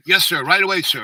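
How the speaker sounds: loud, high-pitched yes-sir; mock snap-to reply to a colonel; acted-out dutiful compliance; military obedience.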